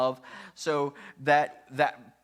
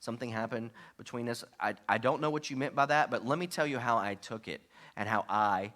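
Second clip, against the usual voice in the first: softer, lower, calm honest tone; gentle complaining; 3 semitones lower, 4 dB quieter than usual. Shown as a demonstration. Spouse calmly sharing hurt feelings, soft and low. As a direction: tell them gently how you feel. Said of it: "here's complaining".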